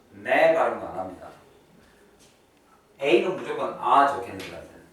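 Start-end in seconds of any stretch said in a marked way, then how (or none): none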